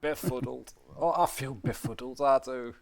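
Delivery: broad northern English voice; northern accent. Shown as a demonstration. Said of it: "That's a very much northern accent".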